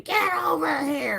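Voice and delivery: high, drawn-out mock yell; imitated scream. Mother yelling to her kid aisles away, mock scream. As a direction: holler it slowly across the aisles, not full volume. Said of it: "And then screaming".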